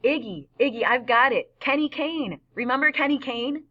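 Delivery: high, urgent burst of recognition; thrilled woman suddenly remembering; excited realization. A narrator's reading. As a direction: blurt the discovery, pitch way up. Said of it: "Loretta jumps up and she goes".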